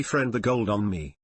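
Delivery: usual speaking voice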